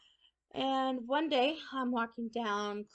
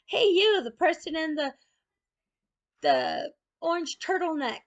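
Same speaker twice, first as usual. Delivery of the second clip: high, loud call-out; retold scream; 7 semitones higher, 7 dB louder than usual. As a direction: yell to single out a stranger. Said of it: "someone scream out".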